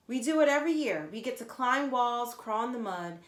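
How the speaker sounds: put-on bragging kid, loud and fast at first; boastful. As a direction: show off to the new kid. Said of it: "boasted Kat".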